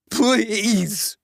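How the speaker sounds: ordinary delivery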